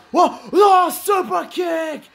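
high, stretched yell of excitement; excited shout. Real, not acted. Commentator roaring at a super kick, excited high shout. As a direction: erupt as the super kick lands.

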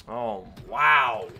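loud, drawn-out "wow"; stunned wow. Real, not acted. Player reacting to the game, loud astonishment. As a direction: exclaim it in loud amazement.

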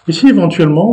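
ordinary talk

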